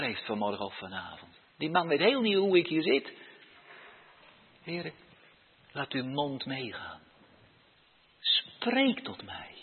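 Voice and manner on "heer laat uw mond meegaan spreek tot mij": modelled prayer. Shown as a demonstration. Closing prayer. (zacht, nederig gebed)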